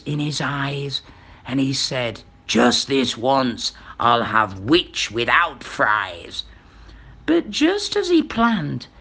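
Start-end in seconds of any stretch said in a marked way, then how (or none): 2.5-6.4 low, slow dragon voice; menacing, drawn-out dragon speech; hungry dragon. A narrator's reading. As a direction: relish it slowly as a deep-voiced dragon. Dragon about to eat the witch.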